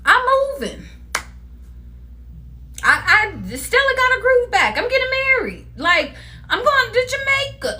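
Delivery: a playful hint of excited confessing; mock excited news. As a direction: blurt the good news like a hype friend. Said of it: "it could be you confessing, like".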